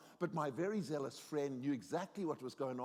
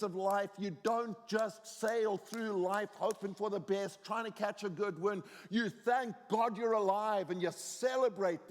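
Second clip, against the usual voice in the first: high, driving sermon exhortation; raised, emphatic pulpit voice; impassioned preaching; 6 semitones higher, 5 dB louder than usual. Real, not acted. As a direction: preach it with rising conviction, hammering key words. Preaches the lesson with force.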